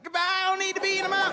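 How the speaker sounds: high, rushed comic farewell; suicidal spit. Acted. Spit fleeing the mouth after a throat punch, high hasty goodbye. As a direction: bail out fast in a high voice. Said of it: "gets suicidal. It's like".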